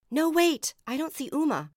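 high, loud, urgent protest; alarmed. A narrator's reading. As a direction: call out in rising worry. Searching for sister in chaos.

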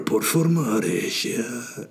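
hammed-up Spanish advert voice; put-on Spanish accent. Podcaster playing out a Spanish beer advertisement, mock Spanish accent. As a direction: savour each word in a fake Spanish accent. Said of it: "full Spanish or advertisement".

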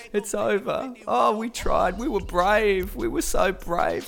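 high, whiny mock sob of a beaten fan; mock crying. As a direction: ham up a teary, self-pitying loser. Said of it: "crocodile tears, saying".

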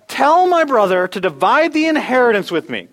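high, loud demand; demanding command. Acted. A man in the crowd ordering Jesus to settle his inheritance. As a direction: order it, don't ask it. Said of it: "he doesn't ask".

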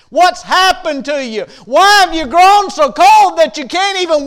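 a revival preacher at full throttle, drawing out each phrase; preaching at full force. Acted. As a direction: preach it out, pleading and rising. Sermon climax, repeated refrain.

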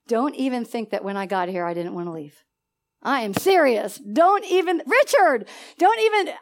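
normal talking voice

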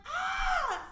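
high laughing shriek; scared squeal. Retold or imitated. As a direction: yelp in mock fright. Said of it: "I was just like".

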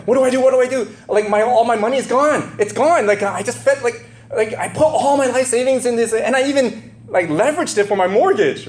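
high, loud, frantic rush of words; imitating a frantic farmer whose savings are gone; panicked pig herder. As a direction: play the herder losing it over his money. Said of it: "They're, like, freaking out".

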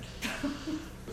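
a quick breathy burst of laughter. Real, not acted. Teacher laughs while acting out inner dialogue, breathy laugh. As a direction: laugh at your own act. Laughs at own mock agreement.

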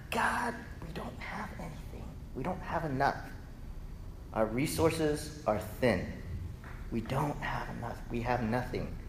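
whispered, soft excuses; timid whispered protest; hushed plea. Retold or imitated. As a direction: plead lack in a small voice.

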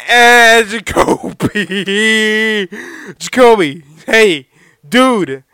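loud, very slow drawn-out call; calling the dog. Real, not acted. Calling his dog over the squeaky toy, loud drawn-out exasperation. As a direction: drag the name out loud and fed up.

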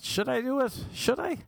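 high, giddy inner voice; tempted inner voice. Retold or imitated. Tempted to drop a famous name, high giddy voice. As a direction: squeak it out, itching to name drop.

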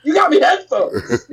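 high-pitched, indignant retold complaint; mad. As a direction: replay a kid's outraged protest to mom. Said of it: "mad as fuck".

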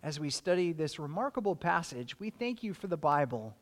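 soft, hushed prayer voice, a little quick; hushed closing prayer after the study; quiet prayer. Real, not acted. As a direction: pray it gently and close.

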